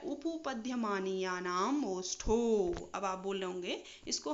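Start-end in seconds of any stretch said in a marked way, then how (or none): 0.0-2.7 उपपद्यमानीयानाम ओष्ठो recited slowly, syllable by syllable; slow sutra recitation. Shown as a demonstration. Teacher to students, slowly reciting the lip sutra. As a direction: lay the long sutra out slowly so it can be followed. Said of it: "देखिए, उपु".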